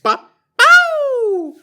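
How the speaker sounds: high howled "pow" noise, drawn out; shouted mock explosion. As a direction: blast out a comic explosion at the top of your voice. Mock explosion capping the gawking bit.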